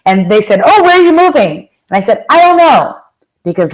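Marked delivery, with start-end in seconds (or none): 0.6-1.7 curious question. Retold or imitated. Friends ask curiously. 2.3-3.0 high, loud carefree answer; high, bright I don't know to friends; breezy I don't know. Retold or imitated. Cheerful not-knowing answer.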